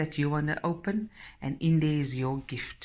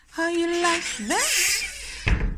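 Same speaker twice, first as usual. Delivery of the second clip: much higher, slow, drawn-out playful squeak; silly squeaky voice; 5 dB louder, much slower than usual. Acted. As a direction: ham up the question in a silly high voice. Repeats the question in another voice.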